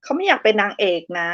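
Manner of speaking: ordinary talk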